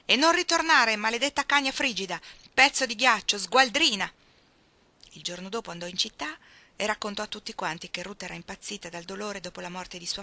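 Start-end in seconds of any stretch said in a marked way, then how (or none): 0.1-4.1 loud, much higher screaming insults; furious shout. Acted. Frank screams after Ruth driving off, furious and loud. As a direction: hurl the insults after the departing car. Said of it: "Frank Bennett gridò alla polvere".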